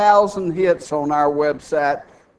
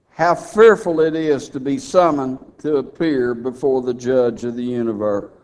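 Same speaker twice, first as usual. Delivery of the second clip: ordinary talk, nothing marked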